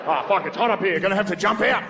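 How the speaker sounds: high mock Australian accent, rising alarm; panicked Australian. Australian guy trapped in the office, comic Australian alarm. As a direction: pitch it up as a distressed Australian. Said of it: "an Australian guy in the office".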